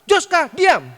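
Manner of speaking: loud teacher's snap; bentakan guru. Retold or imitated. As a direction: bark the order like an angry teacher. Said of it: "anak saya langsung dibentak". (bentakan keras menirukan guru)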